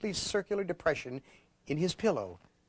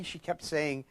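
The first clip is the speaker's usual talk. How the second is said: ordinary delivery, nothing marked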